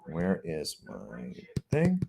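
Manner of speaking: whispered under breath while searching; whispering. Real, not acted. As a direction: mutter it to yourself, barely voiced. Searching desk for lost item.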